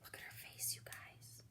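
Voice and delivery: hushed whisper. Real, not acted. Hushed aside to the children.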